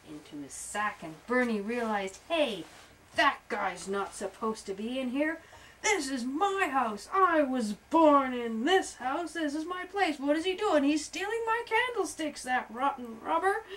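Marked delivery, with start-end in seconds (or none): 2.3-13.6 rising, louder outrage, slowed; indignant. Acted. Beetle fumes at the robber stealing candlesticks. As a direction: build from shock to fury at the thief. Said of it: "got really mad".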